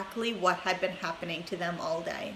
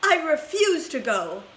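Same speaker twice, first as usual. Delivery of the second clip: firm declaration; 6 semitones higher, 5 dB louder than usual. A narrator's reading. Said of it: "announced Grandpa George".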